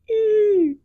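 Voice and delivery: a mermaid's TV-shattering squeal; high-pitched shriek. Retold or imitated. Mermaid in Splash shrieks her name, high and loud. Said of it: "she starts making this noise, and it's, like, very high-pitched".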